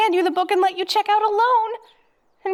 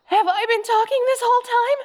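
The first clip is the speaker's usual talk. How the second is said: alarmed gasp; 6 semitones higher than usual. Acted. Sudden panic after a gasp.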